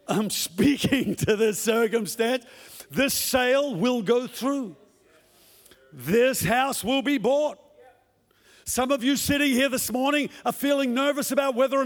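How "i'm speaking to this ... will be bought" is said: slow, firm declaration of faith; bold declaration. Shown as a demonstration. Believer declaring his sale will go through, slow and firm. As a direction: speak each word slowly like a decree.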